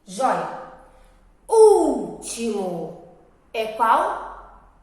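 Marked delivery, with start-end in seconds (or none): 1.5-3.0 "Último" slow, loud long UUL-timo; stress on first syllable. Shown as a demonstration. Teacher asking pupils which syllable is strong, loud drawn-out first vowel. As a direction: stretch and punch the U of "Último".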